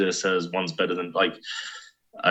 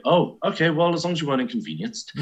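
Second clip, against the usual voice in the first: higher, quick, lightly voiced naive reply; mock literal American; 8 semitones higher, faster than usual. Host imitating an American taking British understatement literally, light mock voice. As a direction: play a cheerfully literal American. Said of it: "you take that very literally of, like".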